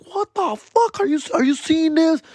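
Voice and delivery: shocked disbelief. Retold or imitated. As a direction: gasp at the sight in disbelief. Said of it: "And I was like".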